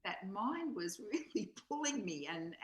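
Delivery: ordinary delivery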